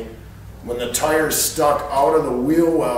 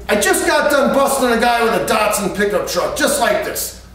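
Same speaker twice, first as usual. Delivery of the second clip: a high, louder mock cop voice; lightly voiced cop bragging; smug cop; 7 semitones higher, 4 dB louder than usual. Cop boasting about an earlier bust.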